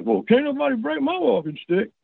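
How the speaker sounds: high-pitched boastful comeback; acted-out kid's defiant boast; defiant retort. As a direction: brag back at the doubters. Boy's boastful retort in retold quarrel.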